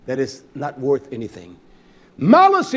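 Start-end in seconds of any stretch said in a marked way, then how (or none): 2.2-2.8 shouted high and loud, Malice; a preacher's sudden cry; booming word. Real, not acted. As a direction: hurl the word at the congregation. Preacher booms key word.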